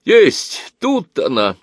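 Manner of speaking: high, excited exclamation; acted burst of discovery; sudden cry. A narrator's reading. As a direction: shout out that you've found it. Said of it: "вскрикнул вдруг один".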